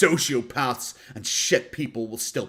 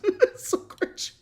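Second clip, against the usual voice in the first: laughing; much slower than usual. Real, not acted.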